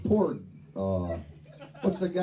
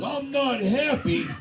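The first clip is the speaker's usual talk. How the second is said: high sulky complaint; grumpy little man; 9 semitones higher than usual. Retold or imitated. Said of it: "So he goes".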